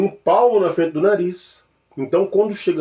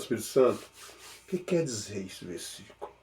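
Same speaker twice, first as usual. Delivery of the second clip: hushed, much softer plea; quiet prayer; 5 semitones lower, 10 dB quieter than usual. Pretend. Believer asking the Spirit, hushed soft prayer. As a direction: murmur the question to God in private. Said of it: "pedindo ao Espírito Santo".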